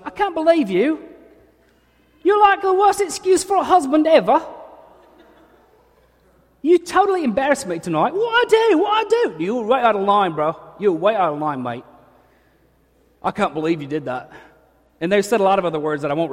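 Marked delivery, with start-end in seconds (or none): 0.1-9.4 much higher, louder furious British wife; shrill angry wife. Retold or imitated. London wife berating her husband after a wedding, much higher and louder. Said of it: "she was like". 9.4-14.3 loud retort in a hint of a London accent; mock London husband. Husband arguing back with his wife in the car, louder. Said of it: "couple fighting in car".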